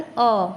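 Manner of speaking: "अ" opened into a long ah; letter A as ah. Shown as a demonstration. Teacher shows the sound of A in car, "अ" as long ah. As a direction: say "अ" like the vowel in car. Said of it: "ए का उच्चारण".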